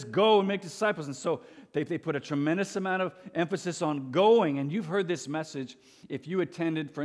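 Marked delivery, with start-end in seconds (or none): 0.0-1.1 "Go" punched higher and louder; stress on Go. Retold or imitated. Preacher quoting Jesus to push missions, heavy stress on "Go". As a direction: lean hard on the word "Go".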